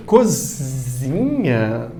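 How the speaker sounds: "Cozinha" very slow, low and soft, each sound stretched; drawn-out word. Shown as a demonstration. Teacher tests whether it is a diminutive, slowly stretched low "Cozinha". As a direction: linger doubtfully over every syllable of "Cozinha".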